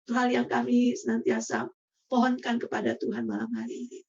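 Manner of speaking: soft, hushed prayer to God. Acted. Leader praying softly to close the group meeting. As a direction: pray quietly for the group.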